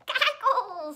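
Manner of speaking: high, loud witchy squeak; playful mother witch voice; witch's squeak. A narrator's reading. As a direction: screech it like an old witch. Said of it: "squeaked the mother".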